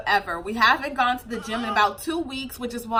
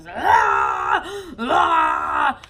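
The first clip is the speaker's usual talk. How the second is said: repeated mock screaming; drawn-out pretend infant screams; imitated baby scream; 2 semitones higher, 4 dB louder than usual. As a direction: imitate a screaming baby, two long bursts. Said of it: "scream like that".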